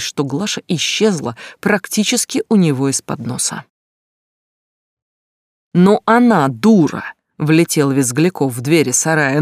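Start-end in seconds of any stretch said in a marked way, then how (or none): 5.7-7.0 furious yell, slow and loud; angry shout. Acted. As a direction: burst in yelling at him. Vizglyakov bursts in shouting. (гневный крик)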